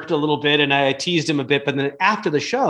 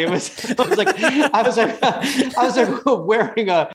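higher voice breaking into real laughter; cracking up mid-sentence; laughing while talking; 6 semitones higher than usual. Real, not acted. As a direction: struggle to speak through the giggles. Laughing at the fake headphones.

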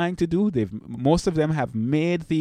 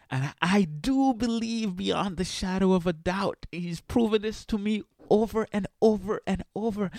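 fervent faith testimony, rising pitch; preaching testimony; 5 semitones higher than usual. Real, not acted. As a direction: testify like in church.

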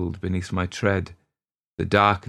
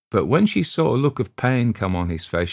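ordinary delivery, nothing marked

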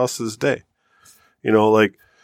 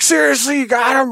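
amazed dad. Retold or imitated. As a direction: shout it in disbelief like a stunned father. Dad amazed, voiced loudly.

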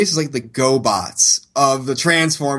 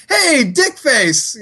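insulting; 7 semitones higher, much slower than usual. Retold or imitated. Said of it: "kinda like an insult".

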